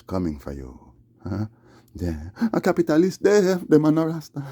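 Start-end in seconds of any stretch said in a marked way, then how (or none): 2.5-4.4 high, loud Jamaican rant; ranting patois. Acted. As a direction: rail at the fake rastas.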